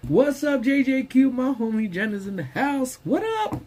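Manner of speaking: high, bright welcome to a friend; excited greeting. Real, not acted. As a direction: call out a happy hello. Greeting viewer joining live.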